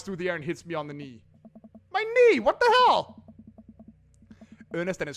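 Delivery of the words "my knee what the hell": much higher, louder cry of surprise; shocked yelp. Acted. Character hit on the knee by a rock, high shocked yelp.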